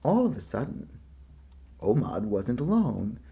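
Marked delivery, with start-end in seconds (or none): none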